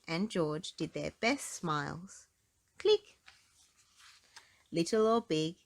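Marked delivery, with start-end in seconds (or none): 2.8-3.2 camera click. Retold or imitated. Camera sound.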